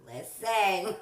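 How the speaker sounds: high, loud, laughing parrot "Listen"; bird copying voice. Retold or imitated. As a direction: copy it like a mimicking bird. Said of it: "to hear her".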